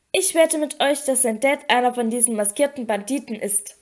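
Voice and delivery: usual speaking voice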